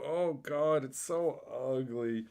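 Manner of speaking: slow, put-on groan of distaste; a half-hearted disgusted sigh; mock disgust. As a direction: drag out so, sounding repulsed. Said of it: "And you just go".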